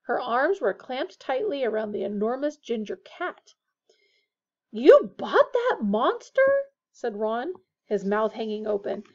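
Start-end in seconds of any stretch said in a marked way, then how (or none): 4.7-6.7 higher, louder, slow disbelief; aghast. A narrator's reading. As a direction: gape at her in horror. Ron aghast at the cat.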